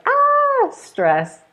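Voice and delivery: tense, strained gasp then stress. Shown as a demonstration. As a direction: act stressed out for a moment. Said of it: "which isn't like".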